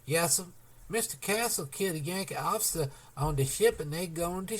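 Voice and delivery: high, breathless fear; gasped report. A narrator's reading. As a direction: blurt it out in fright.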